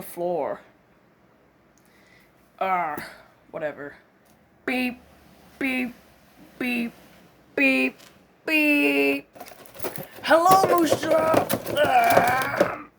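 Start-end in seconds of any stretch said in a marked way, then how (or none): 4.6-9.3 even spoken "beep" repeated like a horn; mock horn beeps. Driver imitating a honking vehicle, steady mock beeps. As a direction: beep like a little car horn.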